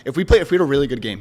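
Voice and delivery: emphatic. Real, not acted. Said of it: "I swear to God".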